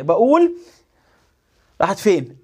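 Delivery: ordinary delivery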